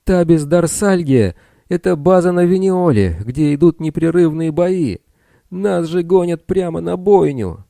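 high, loud desperate outcry; panicked shouting. A narrator's reading. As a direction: cry out in dread. Bill panicking, continues shouting.

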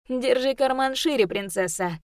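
high, loud, cheeky retort; cocky boy voice. Acted. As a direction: tease her, all swagger. Ru's cocky reply.

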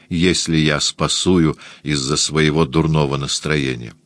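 ordinary delivery, nothing marked

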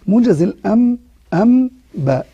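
"موجز الأم" split apart to show meem then ba; slowed breakdown. Shown as a demonstration. Teacher breaks the word down for students, exaggerated. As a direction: isolate "أم" then "باء" to show the hidden meem. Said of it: "بيعمل كده".

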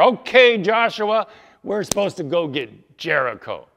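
high, loud challenge to the leader; loud questioning warrior. Acted. Warriors question Joshua about the plan.

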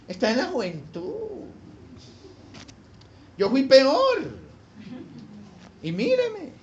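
drawn-out, swooping excuse-making voice; acted permissive dad making excuses; indulgent father. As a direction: brush it off airily, jumping high at the end.

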